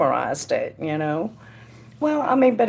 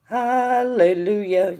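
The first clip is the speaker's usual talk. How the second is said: a slow, drawn-out, higher hallelujah; 4 semitones higher, slower than usual. Real, not acted. Psychic celebrating good news for a viewer, slow joyful hallelujah. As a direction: stretch it out in glad relief.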